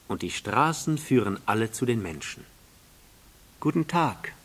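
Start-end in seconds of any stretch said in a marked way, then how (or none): 3.6-4.3 higher-pitched prince's hello; lifted storybook greeting; little prince greeting. Acted.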